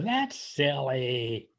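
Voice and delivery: usual speaking voice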